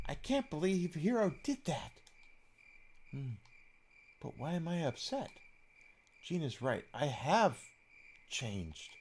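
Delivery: thinking aloud to herself, softly; lightly voiced Lucia. Voicing Lucia musing.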